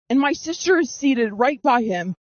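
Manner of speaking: ordinary delivery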